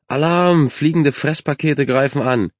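high, loud mock alarm; calling out. A narrator's reading. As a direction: cry out a teasing alarm to everyone. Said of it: "rief ein Junge".

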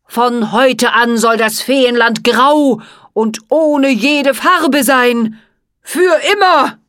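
evil curse. A narrator's reading. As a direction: pronounce doom on the whole fairy land. Jack Frost casts a curse.

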